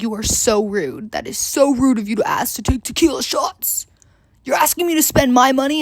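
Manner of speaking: imitating an indignant man telling someone off; hammed-up scolding with mock outrage; mock-offended telling-off; indignant retold rant. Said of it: "he was still yelling at me".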